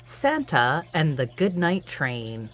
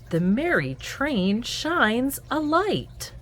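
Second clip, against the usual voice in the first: lively sing-song picture-book read-aloud; reading to children; 7 semitones higher, 3 dB louder, slower than usual. A narrator's reading. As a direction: read it to little ones at bedtime.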